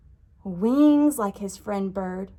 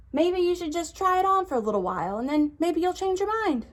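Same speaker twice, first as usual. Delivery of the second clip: high and quick, gently persuading; coaxing tiger voice; 8 semitones higher, faster than usual. Acted. Tiger coaxing Churchill to keep the tail, high and quick. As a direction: talk him into it, lightly and fast.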